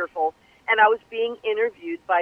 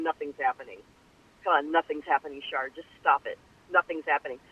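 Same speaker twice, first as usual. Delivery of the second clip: low, soft, deflated talking to herself; glum self-talk; 8 semitones lower, 6 dB quieter than usual. Retold or imitated. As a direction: tell yourself it's hopeless, quietly. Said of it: "I get really depressed and going".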